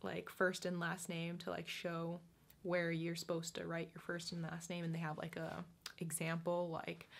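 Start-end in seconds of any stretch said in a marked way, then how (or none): none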